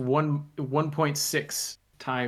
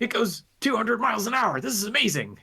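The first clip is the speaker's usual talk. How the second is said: higher, excited retelling of a first bullet-train ride; imitating own giddy self, pitched up; 10 semitones higher than usual. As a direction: bubble over like a kid on a train. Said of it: "I was practically giddy".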